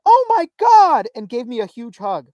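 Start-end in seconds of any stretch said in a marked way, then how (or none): 0.1-1.1 loud, high, drawn-out squeal; excited greeting. Retold or imitated. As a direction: mimic an overjoyed greeting at full volume. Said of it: "And she went".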